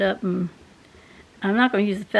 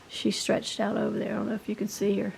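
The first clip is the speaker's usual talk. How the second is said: hushed; 7 dB quieter than usual. Real, not acted. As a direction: murmur so the dog stays asleep. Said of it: "I don't wanna make too much noise".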